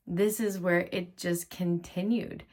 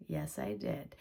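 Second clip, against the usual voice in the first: normal talking voice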